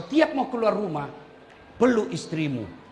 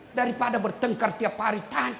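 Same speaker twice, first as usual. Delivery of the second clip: ordinary talk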